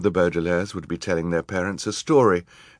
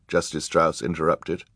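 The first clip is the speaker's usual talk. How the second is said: ordinary delivery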